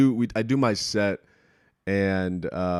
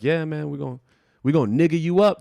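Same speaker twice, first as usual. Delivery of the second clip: imitating her riff; 7 semitones higher, 4 dB louder than usual. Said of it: "she was like".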